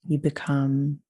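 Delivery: unhurried visualisation in spaced-out phrases; guided meditation. Acted. Leads woods visualisation.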